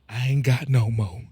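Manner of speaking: low, softened retold confession; low mock-confession with added flavor; embellished imitation. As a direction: lightly imitate him, with a little sauce. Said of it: "I put the little sauce on it".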